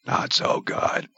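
slow, strained, voiceless rasp; raspy whisper. Retold or imitated. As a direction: whisper it hoarsely, barely getting it out. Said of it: "I'm like".

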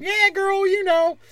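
squealing heckler. Retold or imitated. Comic mocks a heckler cheering women, squealing high. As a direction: squeal it like an overeager fan. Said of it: "Every time a chick comic goes on".

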